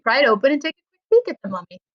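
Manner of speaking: ordinary delivery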